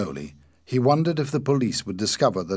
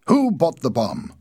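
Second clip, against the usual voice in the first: demanding; 6 semitones higher, 7 dB louder than usual. A narrator's reading. Ossipon demanding, still angry.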